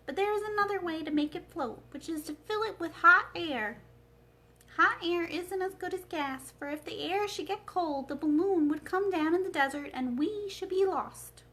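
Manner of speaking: a hint of a thoughtful old wizard; Oz's voice. The wizard shares his balloon plan with Dorothy, lightly voiced. Oz explains hot air.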